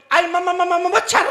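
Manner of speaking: high stammer on "म-, म-, मच्छर"; stammering bride. Acted. Stammering bride spotting a mosquito, high stutter. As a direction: stutter "म-" repeatedly before "मच्छर". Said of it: "दुल्हन भी हकली".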